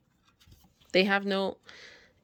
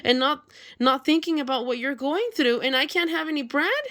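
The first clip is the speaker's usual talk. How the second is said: high, whining voice all through; whiny begging animal; 7 semitones higher than usual. Pretend. Greedy friends whining to the hen for bread, high. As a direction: whine like a spoiled pet.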